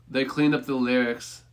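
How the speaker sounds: usual speaking voice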